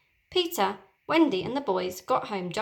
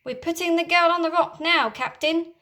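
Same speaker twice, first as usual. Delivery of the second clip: pirate shout; 8 semitones higher, 4 dB louder than usual. Acted. Pirate answers the captain.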